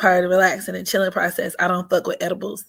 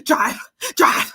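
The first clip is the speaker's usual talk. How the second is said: mock crying plea, higher; panicked friend; 4 semitones higher than usual. Friend begging Megan to drive, mock crying panic. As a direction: imitate her begging to drive, near tears. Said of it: "she's like".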